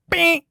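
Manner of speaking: high loud horn imitation; air horn blast. Heckler's air horn in his ear, high loud blast. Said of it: "He goes".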